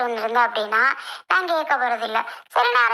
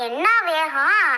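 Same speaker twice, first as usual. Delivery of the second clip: ordinary delivery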